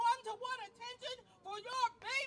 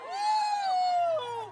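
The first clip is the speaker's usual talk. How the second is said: rally whoop; 10 semitones higher, 9 dB louder than usual. Real, not acted. Crowd cheer at rally.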